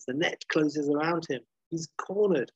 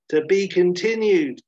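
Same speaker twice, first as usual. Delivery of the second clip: loud, high cliffhanger title; title announcement; 5 semitones higher, 8 dB louder than usual. Retold or imitated. Storyteller reads the title ending part one, big announcement. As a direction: announce it big, pitched up and punchy.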